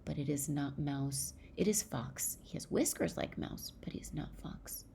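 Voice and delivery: usual speaking voice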